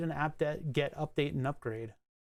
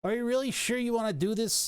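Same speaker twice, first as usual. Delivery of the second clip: higher, put-on questioning voice; mock script prompt; 6 semitones higher than usual. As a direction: voice a nagging computer prompt, pitched up.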